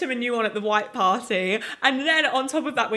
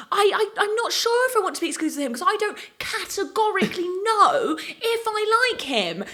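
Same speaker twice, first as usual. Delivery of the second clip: imitating Molly-Mae; 11 semitones higher than usual. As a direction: draw out categorically like her. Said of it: "now that was a good accent".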